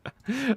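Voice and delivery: bright high burst of laughter; real laugh. Real, not acted. Streamer to chat, laughing at a memory. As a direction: laugh out loud before the story. Laughs before telling cop story.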